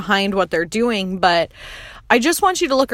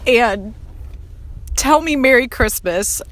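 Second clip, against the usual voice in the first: ordinary talk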